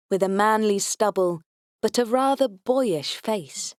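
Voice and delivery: ordinary delivery, nothing marked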